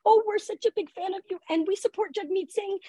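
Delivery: eager parent greeting the driver, high and hushed; gushing parents. Retold or imitated. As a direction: coo it like an adoring fan. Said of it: "and they say".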